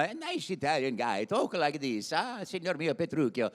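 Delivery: put-on theatrical Italian-accented character voice; Gremio. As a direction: play a genial stage Italian. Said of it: "I had done Gremio in Taming of the Shrew".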